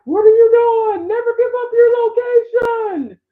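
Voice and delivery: high, loud panicked scream; screaming inner victim. Acted. Inner stalking victim to interviewees, screaming. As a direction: scream it out like an alarmed warning. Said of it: "my inner organized stalking victim is like".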